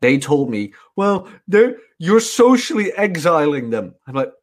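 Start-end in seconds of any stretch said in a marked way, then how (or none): 0.9-3.9 higher-pitched imitation of a protesting friend; mock objecting. Friends objecting to no tablets for the children, mock objecting. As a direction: mimic a friend's scolding objection.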